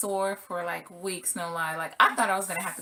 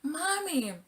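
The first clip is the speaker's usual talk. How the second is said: put-on little boy; 9 semitones higher than usual. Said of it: "He was like".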